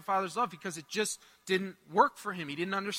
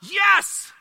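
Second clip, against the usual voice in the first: a shouted, high burst of joy; excited yes; 11 semitones higher, 11 dB louder than usual. Retold or imitated. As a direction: explode with delight. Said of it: "my only response was like".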